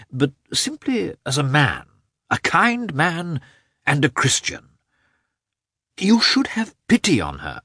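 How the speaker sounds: high, earnest appeal in character; Oblonsky. A narrator's reading. Oblonsky appeals to Karenin for pity, high and earnest.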